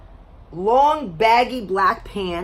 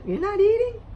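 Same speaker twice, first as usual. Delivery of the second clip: high mock quote; 12 semitones higher than usual. Imitating last date.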